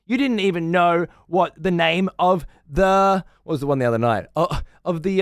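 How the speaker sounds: high, loud scornful presenter; mock the contestant as an idiot; mock sneering host. Host sneering at the wrong answer, high and loud. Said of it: "host presenter".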